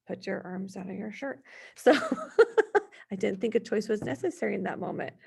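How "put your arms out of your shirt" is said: much softer, tossed-off instruction; quiet offhand request to a son; casual low-key ask. Retold or imitated. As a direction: say it lightly under the breath. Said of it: "it's just".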